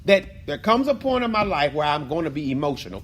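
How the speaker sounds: ordinary talk, nothing marked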